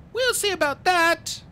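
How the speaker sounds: put-on very high, loud girl's voice; high-pitched, loud defiance; defiant girl. A narrator's reading. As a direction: retort in a high child's voice.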